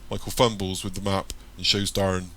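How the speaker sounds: plain reading voice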